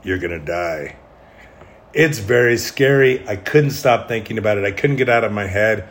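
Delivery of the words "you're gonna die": low, hushed imitation of a demon. Demon in the head warning a sleeper, low and quiet. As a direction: drop down low and soft as an inner demon. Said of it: "I would hear this demon-like voice".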